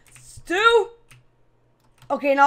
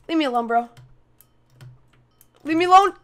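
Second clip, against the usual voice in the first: ordinary talk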